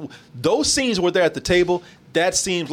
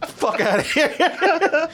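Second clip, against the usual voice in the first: high, slow, laughing fake Russian; mock Russian accent; 12 semitones higher, much slower than usual. Mocking the movie's bad Russian accents, laughing. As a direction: ham up a silly Russian accent through laughs. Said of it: "Written by Yakov Smirnoff".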